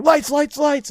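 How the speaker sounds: high shouted wake-up call; yelling wake-up call. Retold or imitated. Recruit recalls the morning wake-up, high shouted call. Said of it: "everyone yelling".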